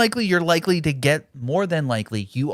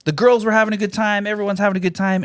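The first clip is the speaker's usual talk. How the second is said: higher, bright fake-cheerful report; chirpy upbeat voice; 7 semitones higher than usual. To a friend in the car, fake-cheerful. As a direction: sell it like everything is great. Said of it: "I was like".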